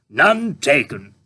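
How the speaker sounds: a hungry tramp asking; puzzled tramp. A narrator's reading. Tramp character.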